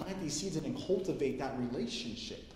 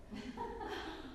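startled laugh; 13 semitones higher, 13 dB quieter than usual. Real, not acted. Laughing at porn star reveal.

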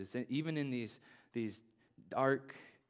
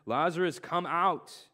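louder call to the tomb; commanding call; 4 semitones higher, 6 dB louder, slower than usual. A narrator's reading. Jesus shouts Lazarus out of the tomb, loud. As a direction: call him out of the grave. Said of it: "Then Jesus shouted".

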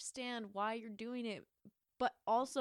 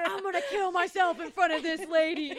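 mock wail; 10 semitones higher, 9 dB louder than usual. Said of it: "He's like".

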